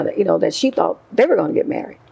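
ordinary delivery

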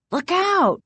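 high-pitched warning cry. A narrator's reading. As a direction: call out a sudden warning, pitch jumping up. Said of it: "Casey cried".

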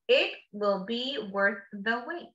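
ordinary talk, nothing marked

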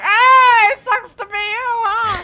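high, loud gloating villain taunt; mocking skull guy voice. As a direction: jeer like a cackling skull. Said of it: "He's like".

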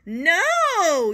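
high, loud yell of no; children yelling a loud no. Acted. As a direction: shout it like a horrified class. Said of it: "yelled the children".

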